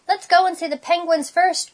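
high, excited urging; eager cry. A narrator's reading. Splat arriving at the zoo, eager cry. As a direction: push eagerly to go first. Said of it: "cried Splat".

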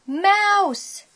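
high-pitched exclamation; alarmed cry. A narrator's reading. Classmates spot Seymour in the lunchbox, excited cry. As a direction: shout it on spotting prey.

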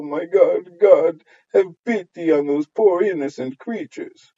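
high, loud, scandalised wailing; gossiping neighbours' outcry. Acted. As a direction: cry out in mock horror to the whole street.

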